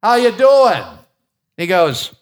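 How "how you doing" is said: a bit louder, mild mock raised voice; louder greeting. Preacher retelling how he greeted a stranger on the plane, somewhat louder. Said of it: "So I said it louder".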